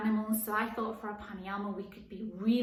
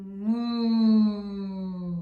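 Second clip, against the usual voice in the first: cow moo; 9 dB louder than usual. Pretend. Teacher mooing for the cow yoga pose, loud.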